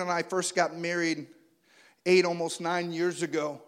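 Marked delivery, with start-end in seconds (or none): none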